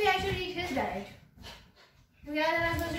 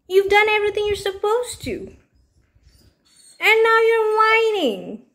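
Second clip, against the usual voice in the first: high scolding pet voice; 7 semitones higher, 10 dB louder than usual. Real, not acted. Scolding the whining dog.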